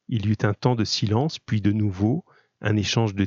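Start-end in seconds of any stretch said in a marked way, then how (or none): none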